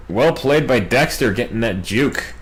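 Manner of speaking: ordinary delivery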